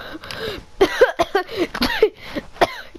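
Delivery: real, high choking cough; coughing fit. Real, not acted. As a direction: choke and splutter for breath. Choking fit mid-game.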